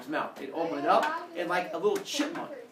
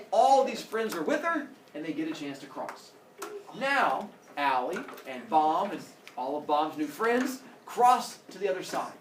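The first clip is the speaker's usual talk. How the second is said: normal talking voice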